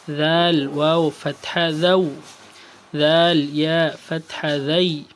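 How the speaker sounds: slow, firm Arabic spelling, each syllable drawn out; slow recitation drill. Shown as a demonstration. Teacher recites the leen letters for students, slow and firm. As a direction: chant the leen letters slowly and clearly for the class. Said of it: "ان کا pronunciation آپ کے سامنے ہے۔".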